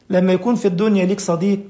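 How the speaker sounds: ordinary delivery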